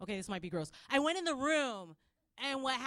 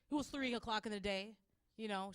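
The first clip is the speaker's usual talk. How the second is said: usual speaking voice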